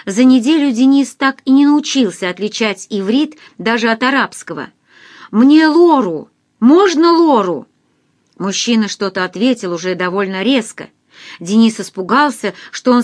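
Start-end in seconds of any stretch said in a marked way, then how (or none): 5.3-7.7 raised, slow pleading call; urgent phone plea. A narrator's reading. Denis begging a stranger on the phone, loudly. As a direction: push to be understood down the line.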